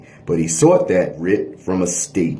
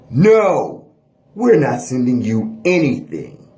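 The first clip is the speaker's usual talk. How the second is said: defiant refusal; 4 semitones higher than usual. Pretend. Wisconsin court voiced refusing.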